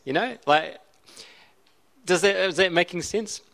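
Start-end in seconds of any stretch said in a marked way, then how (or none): none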